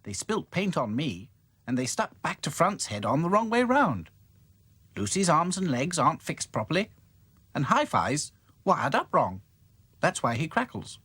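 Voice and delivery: bright doll explaining; Dotty voice. Acted. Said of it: "explained Dotty".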